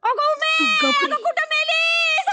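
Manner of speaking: high, slow drawn-out call. Acted. Mother-in-law calling for Uma, high and drawn out. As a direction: call out for Uma across the house.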